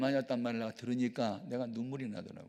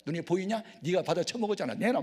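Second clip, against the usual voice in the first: put-on fast, louder drunken taunt; drunken yelling; 5 semitones higher, 5 dB louder than usual. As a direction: hurl the accusation fast and hot. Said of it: "막 그러는 거예요".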